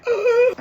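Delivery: emotional groan. Real, not acted. Seeing the gift, emotional.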